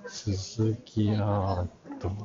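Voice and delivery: ordinary talk, nothing marked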